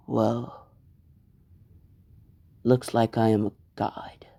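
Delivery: whispered gloat from a cold new villain; menacing whisper. Acted. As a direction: hush it like a villain claiming the throne. Villain Deku gloats over beaten classmates.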